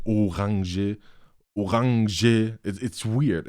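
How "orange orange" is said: Orange said twice as German "o-RAHN-zhuh", drawn out; German pronunciation. Shown as a demonstration. Showing how weird German sounds, Orange overpronounced in German. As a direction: exaggerate German Orange, guttural r and stressed middle. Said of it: "it sounds just weird".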